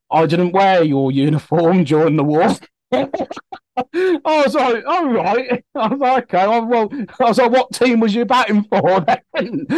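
high-pitched, laughing retelling of a funny exchange; tickled, laughter breaking up the words. Real, not acted. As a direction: talk through helpless giggles, pitched high.

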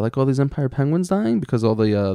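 ordinary talk

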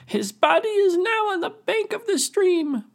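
high, loud squeaky creature voice; little crayfish voice. Acted. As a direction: announce it in a tiny high voice. Little crayfish reports to chief.

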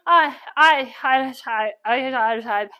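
mock speechless sputtering; flustered stammer. Told to wash her feet, flustered stammering. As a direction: stammer on the one word, lost for an answer. Acting out being dumbfounded.

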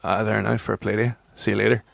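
put-on easy drawling Donegal accent. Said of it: "you can do a Donegal accent".

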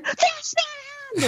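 high, slow mock exclaim; cinema outburst. Actor retelling shouting at premiere screen, high slow mock exclaim. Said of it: "in the cinema and going".

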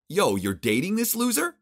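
high, loud, incredulous jeer; Vincent's outraged voice. Acted. Vincent confronting his sister about her boyfriend, loud incredulous jeer. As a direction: spit out the disbelief at the loser.

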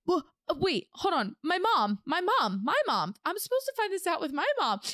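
high flustered rush; flustered excitement. Retold or imitated. Realizing she wants her mom, high flustered rush. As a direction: tumble over the words, flustered.

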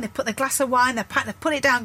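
ordinary talk, nothing marked

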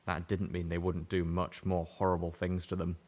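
usual speaking voice

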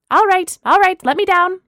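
loud, high-pitched demand; flustered protest. Acted. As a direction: yelp to be put down. Landlord lifted on his broom.

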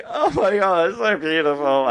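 high, mock-tearful gushing; overcome with emotion. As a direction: act drunkenly moved by a song. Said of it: "how emotional the song made".

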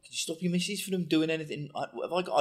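a wobbly Scouse footballer voice; attempted Scouse. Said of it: "Is that Scouse?".

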